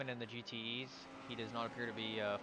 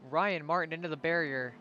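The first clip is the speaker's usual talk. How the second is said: loud, raised play-by-play; punchy, higher commentator call; excited crash call; 4 semitones higher, 7 dB louder than usual. Real, not acted. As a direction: announce the crash with force. Calling the crash.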